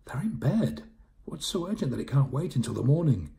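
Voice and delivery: high, indignant householder; high-pitched, put-out objection; disapproving. Acted. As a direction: scold the late callers, pitched right up. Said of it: "Frowning, she said".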